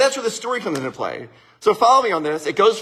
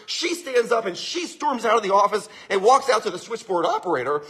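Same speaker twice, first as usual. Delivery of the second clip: plain reading voice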